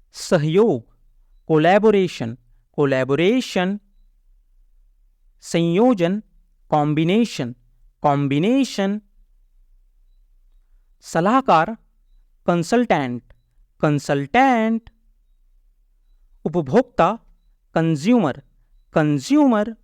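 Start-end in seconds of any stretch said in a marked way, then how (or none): none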